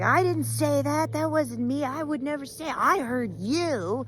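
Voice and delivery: high, loud flustered denial; denying animal. Acted. Accused animal denies the rumor, flustered and high. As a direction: protest innocence in a panic. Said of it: "the other animal would be like".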